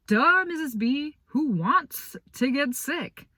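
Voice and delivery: high, drawn-out sarcastic duh; sarcastic teen. Retold or imitated. Boy answering the teacher with sarcastic duh. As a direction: roll your eyes at her.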